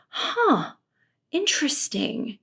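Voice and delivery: high then soft, puzzled "huh"; bemused reply. Retold or imitated. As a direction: mimic a dazed, polite shrug. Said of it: "he's like".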